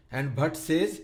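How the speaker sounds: usual speaking voice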